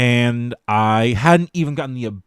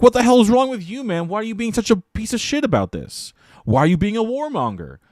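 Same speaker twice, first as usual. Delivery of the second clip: very high, incredulous scolding; hammed-up outrage, pitched way up; mock accusation; 11 semitones higher than usual. Everyone in the restaurant to the diner, mock outraged.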